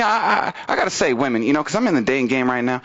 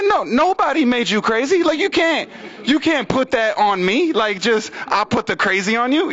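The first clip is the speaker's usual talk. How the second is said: raised-pitch comic indignation; exasperated rebuttal; 7 semitones higher than usual. Retold or imitated. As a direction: argue back, stretching the protest. Arguing back at girlfriend.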